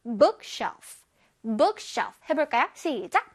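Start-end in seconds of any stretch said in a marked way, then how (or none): 0.0-2.2 bookshelf with BOOK stressed at the front. Shown as a demonstration. Teacher drills children on bookshelf, stressing BOOK. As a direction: hit the first syllable BOOK of bookshelf. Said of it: "앞에 B O O K의 강세가".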